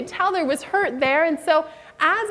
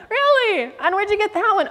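eager interest; 6 semitones higher than usual. Retold or imitated. Eager follow-up question.

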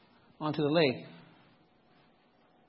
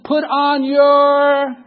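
slow, emphatic; 5 semitones higher, 6 dB louder, much slower than usual. Retold or imitated. Recalling the life jacket warning.

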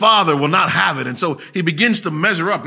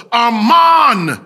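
loud desperate call into a hole; frantic father shouting for his son; yelling a name; 5 semitones higher, 6 dB louder, much slower than usual. Retold or imitated. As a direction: call down into the dark, full voice. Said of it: "The father yells into the hole".

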